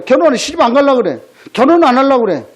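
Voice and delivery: ordinary talk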